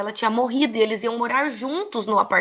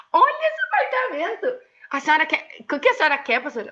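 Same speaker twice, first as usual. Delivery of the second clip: high, exclamatory mock disbelief; chiding a crying pastor over her car; incredulous teasing; 6 semitones higher than usual. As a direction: marvel at the car to shame her tears. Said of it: "Aí eu falava assim".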